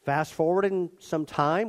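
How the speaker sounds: ordinary talk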